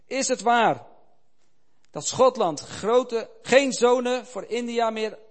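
loud, high preaching, slowed on the key words; impassioned appeal. A narrator's reading. Old missionary pleads with Scotland to send its sons, loud and high. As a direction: plead with all his force.

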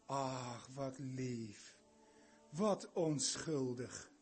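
low, soft doting coo; cooing over a baby. Retold or imitated. Preacher imitating parents cooing over their baby, soft and low. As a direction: melt over the sweet baby.

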